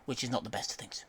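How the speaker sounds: wry whispered aside; whispering. Real, not acted. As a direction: drop to a whisper, sheepish.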